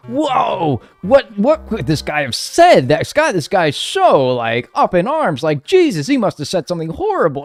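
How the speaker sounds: high, slowed, wide-eyed mock astonishment; hammed-up gossiping viewer; mock shocked onlooker. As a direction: ham up a gasping bystander. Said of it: "it would be funny to be like".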